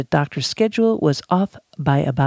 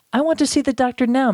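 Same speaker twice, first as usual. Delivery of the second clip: high, quick, insistent reading; mildly irritated patient, pitched up without shouting; impatient demand; 8 semitones higher, faster than usual. A narrator's reading. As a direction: press the demand, hurried and high. Patient's demand in second take.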